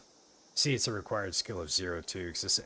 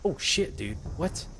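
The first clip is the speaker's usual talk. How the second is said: startled; 11 semitones higher, slower than usual. Real, not acted. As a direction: jump at the sight. Sudden creature appears.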